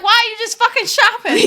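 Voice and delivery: high, loud, mock-outraged demand; exasperated yell. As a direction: yell at your friend caught shopping online. Catching friend shopping, exasperated.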